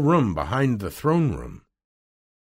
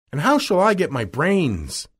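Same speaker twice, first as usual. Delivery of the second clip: high, mildly raised exclamation; crying out; 10 semitones higher, 4 dB louder than usual. A narrator's reading. Scarecrow worrying about his brains, crying out. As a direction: cry out the question, pitched up. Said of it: "cried the Scarecrow".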